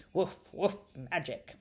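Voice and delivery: a light playful bark then magic; doll dog. Acted. Doll dog echoing magic, lightly barked. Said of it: "and the doll dog said".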